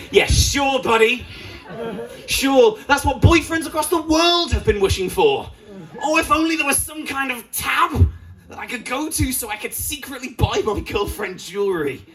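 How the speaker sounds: sarcastic high mocking voice; high whiny sarcasm mocking the ad guy. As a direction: scoff at the advert in a high put-on voice. Mocking the advert man.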